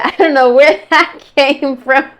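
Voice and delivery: amused at herself, giggle it out; laughing. Real, not acted. Laughing at her own accent slip to viewers.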